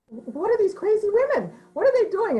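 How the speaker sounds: baffled British. Retold or imitated. British colonists seeing the women's war, high baffled voice. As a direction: gasp at the strange scene. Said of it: "the British were like".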